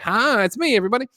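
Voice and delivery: high, bright stage greeting; cheery showman. Acted. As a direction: pop up like a showman. Fake Hugh Jackman pops up.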